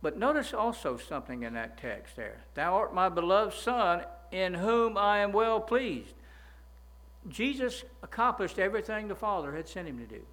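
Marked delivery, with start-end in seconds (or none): 2.5-6.1 higher, louder and slow solemn proclamation; rising, loud, slow intoning of the verse; voice of God. Retold or imitated. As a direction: lift the Father's words up high and slow. Preacher quotes God's voice.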